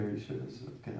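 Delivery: quick hushed check-in; whispered aside. Real, not acted. Aside to listener mid-story.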